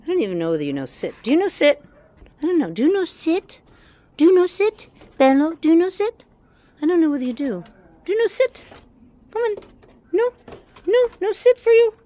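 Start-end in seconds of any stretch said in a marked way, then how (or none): 1.2-6.3 much higher, louder sing-song for the dog; pet-talk voice. Real, not acted. 6.8-11.9 pet-talk voice. Real, not acted. Teasing dog in pet voice.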